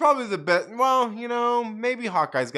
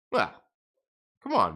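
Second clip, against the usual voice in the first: ordinary delivery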